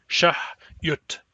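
"Shahyutk" with T released fully before the K; "Shahyutk" with no overlap of T and K; separate T then K. Shown as a demonstration. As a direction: sound the T, then the K. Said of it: "Say them one after the other".

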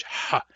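strained throaty ha; effortful pharyngeal ha; effortful back H. Shown as a demonstration. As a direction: push the ha from the throat. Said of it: "effort to say the back H".

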